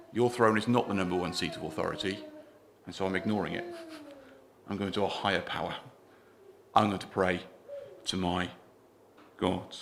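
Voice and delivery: hushed, steady Daniel answering the throne; quiet resolve. Acted. Preacher voicing Daniel quietly refusing the king's throne. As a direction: say it softly, calm and certain. Daniel's defiant reply to the king.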